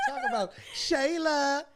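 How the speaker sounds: high laughing tease; laughing, teasing. Real, not acted. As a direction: laugh through the name. Host laughs through prompt.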